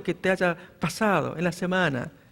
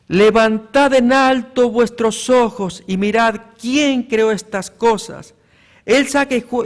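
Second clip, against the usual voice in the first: preaching scripture; 2 semitones higher, 6 dB louder than usual. Acted. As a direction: declaim the verses to the whole church. Reads Isaiah aloud.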